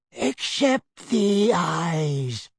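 very slow, a light hint of wheeze; an old wizard, breathing through the words; wheezy old wizard. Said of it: "said a wheezy-voiced".

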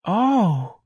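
high, drawn-out "oh" sigh; performer's put-on sigh; stagey sigh. A narrator's reading. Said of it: "and sighed".

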